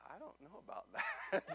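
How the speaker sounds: son's line said through a laugh. Retold or imitated. Pastor retelling his son after the ride, laughing. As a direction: chuckle your way through it.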